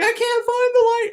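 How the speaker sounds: mimicked panicked wail, high and loud; loud, high mock panic; freaking out. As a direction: shriek it like a panicking friend. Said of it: "like, freaking out".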